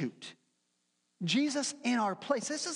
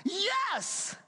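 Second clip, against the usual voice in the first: much higher, eager yes from God; delighted God voice; excited God; 12 semitones higher than usual. Acted. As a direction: squeal it like an overjoyed father. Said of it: "And God's like".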